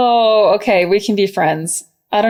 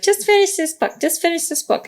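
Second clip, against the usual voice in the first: imitating small child; 9 semitones higher than usual. Mother imitating her daughter begging to finish the book. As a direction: mimic a child begging for more story.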